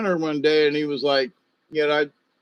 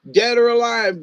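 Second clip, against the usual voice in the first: higher, louder insistence; emphatic; 9 semitones higher, 5 dB louder than usual. Real, not acted. Refusing burial near the neighbor, loud and high. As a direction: push it out firmly.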